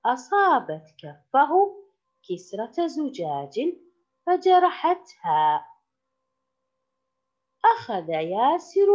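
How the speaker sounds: put-on slow, emphatic reading for children; story read-aloud. A narrator's reading. Teacher reading a story to pupils, slow expressive read-aloud. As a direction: read each phrase clearly with lifted, swooping pitch.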